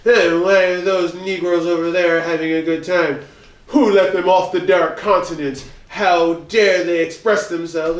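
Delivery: mock indignant European, drawn out and slow; hammed-up affronted outsider voice; upset European. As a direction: play up the scandalized outrage of an onlooker. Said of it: "they're upset and they're like".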